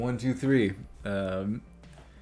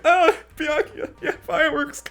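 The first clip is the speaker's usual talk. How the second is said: choked-up whimper; 8 dB louder than usual. Retold or imitated. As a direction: sound close to tears over a lost father. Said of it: "I'm like".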